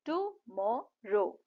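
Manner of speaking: three separate falling beats on टुमारो; syllable by syllable. Shown as a demonstration. Teacher recaps three sounds in टुमारो, syllable by syllable. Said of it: "तीन sound आ रहे हैं".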